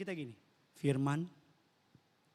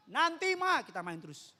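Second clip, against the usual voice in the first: put-on child calling back; 10 semitones higher, 4 dB louder than usual. Children yell back to mother.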